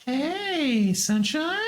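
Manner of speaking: sweet falsetto singsong; high cooing greeting. Pretend. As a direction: call to a cute animal, very high.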